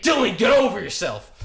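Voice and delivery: mock teacher yell. Teacher pretending to scold student, loud mock scolding. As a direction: scold like you mean it, for show. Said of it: "he's like".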